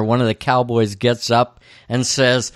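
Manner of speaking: ordinary talk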